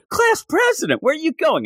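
high-pitched mock call after someone; squeaky retold cry after a runaway; imitated calling out. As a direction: call out in startled alarm, hammed up. Classmates call after fleeing girl.

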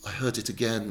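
ordinary delivery

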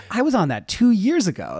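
high, amused disbelief at being late news; incredulous inner thought. Retold or imitated. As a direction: toss it off like wry disbelief. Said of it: "And it's like".

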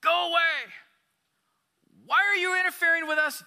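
high, loud demonic shout. A narrator's reading. Pastor voicing a demon crying out at Jesus, loud high shout. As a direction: snarl it out as a possessed man's demon. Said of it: "cried out, shouting".

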